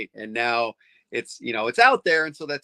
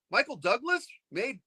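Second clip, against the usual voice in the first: higher, rising disbelieving question; incredulous question; 11 semitones higher, 4 dB quieter than usual. Retold or imitated. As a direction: ask it like you can't believe it. Fan asks in disbelief.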